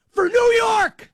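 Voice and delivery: slow rising fan's roar; triumphant shout. Real, not acted. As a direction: belt it out for the city. Triumphant cry for his city.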